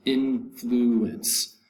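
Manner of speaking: "influence" drawn out in three parts; syllable by syllable. Shown as a demonstration. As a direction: separate IN, FLU and ENCE in "influence". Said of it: "Three syllables".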